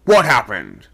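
loud sharp demand; calling out. A narrator's reading. Second mate demanding an answer from the crew, loud. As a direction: bark the question at the men. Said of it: "was singing out".

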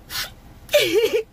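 high wailing fake sob, hammed up; a hammed-up boo-hoo wail; mock sobbing. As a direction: pretend to bawl like a faker. Mocking the roommate's fake crying.

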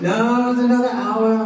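a hint of a stern parent at the wheel; mock stern driver. Driver voiced in road-trip story.

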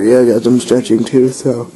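ordinary talk, nothing marked

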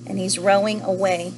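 ordinary delivery, nothing marked

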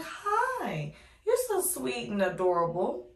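put-on baby talk to a child. Greeting a cute toddler back.

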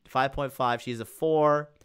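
ordinary talk

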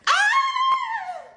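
very high, loud shriek; shrill scream. Acted. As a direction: let out a startled scream. Shriek during the fight.

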